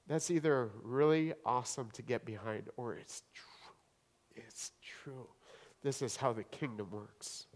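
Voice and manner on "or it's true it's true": slow hushed whisper; breathy-free voiceless whisper of conviction. Real, not acted. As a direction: drop to a whisper, let it land. Hushed conviction after sermon climax.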